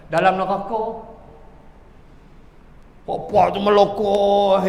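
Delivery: ordinary delivery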